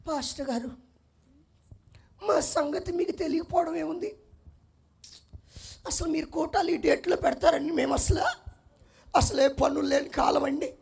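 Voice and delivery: butter up the pastor softly; fawning plea. Retold or imitated.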